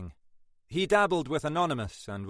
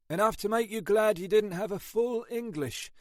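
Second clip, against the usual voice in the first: higher, lightly voiced weary copper; tired detective banter; 10 semitones higher than usual. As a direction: play a worn-out sergeant grumbling. Ashford warns Monroe.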